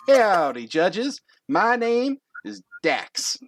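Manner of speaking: high, showy introduction with swagger; all confident. Acted. Confident contestant greeting the judges, big showman's swagger. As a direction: announce yourself slowly and cockily. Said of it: "all confident".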